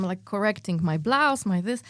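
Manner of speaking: ordinary talk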